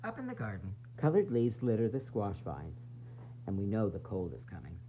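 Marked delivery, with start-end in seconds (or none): none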